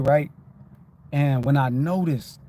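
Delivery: usual speaking voice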